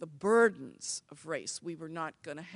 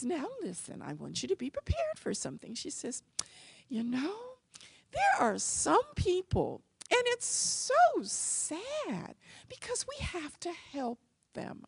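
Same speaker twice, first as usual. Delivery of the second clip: gentle mom voice; 10 semitones higher, 3 dB louder than usual. Retold or imitated. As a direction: coax the child kindly. Mom explains racists to daughter.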